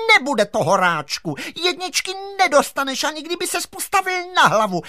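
very high, forceful teacher voice; strong-voiced teacher. A narrator's reading. Teacher refuses the giant top marks, in a strong voice. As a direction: scold firmly, way up high. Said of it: "řekla silným hlasem".

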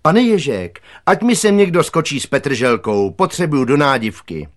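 high, loud call across a yard. A narrator's reading. Cook calls to the gardener from the kitchen window, calling out. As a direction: call from the window for someone's help. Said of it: "kulatá paní kuchařka a zavolala".

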